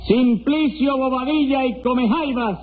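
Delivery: high, drawn-out roll call; singsong high summons; court crier calling names. Acted. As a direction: call the name out slowly across the courtroom. Court crier calls the parties.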